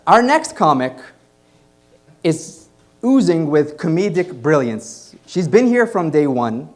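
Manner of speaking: loud, building emcee introduction; announcer intro. Acted. Host announcing the next comic to the audience, loud and building. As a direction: hype up the next comic for the crowd.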